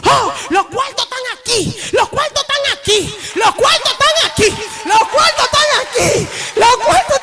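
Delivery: high, loud joyful shout; excited shouting. Retold or imitated. Coworker yelling that the money is in, high excited shout. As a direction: shout it out in disbelief and joy.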